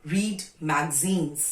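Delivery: slow dictation. Shown as a demonstration.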